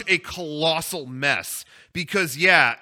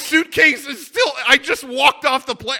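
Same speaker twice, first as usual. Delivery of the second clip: panicked realization; 6 semitones higher, 5 dB louder than usual. Real, not acted. Realizing he left his carry-on.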